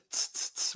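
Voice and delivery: "tss" as a sharp hissed T with no vowel; isolated T sound. Shown as a demonstration. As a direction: pop "tss" off the tongue tip, unvoiced. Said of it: "just pronouncing this".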